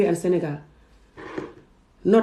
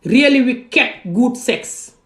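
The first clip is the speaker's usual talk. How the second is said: high, drawn-out stress on "wicked"; imitating their emphasis; 6 semitones higher, 5 dB louder, much slower than usual. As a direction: quote them with "wicked" stretched out high and loud. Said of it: "This is how they say it".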